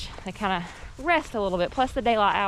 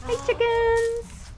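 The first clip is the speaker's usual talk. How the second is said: high call to chickens; 13 semitones higher, much slower than usual. Real, not acted. Greeting her chickens in yard.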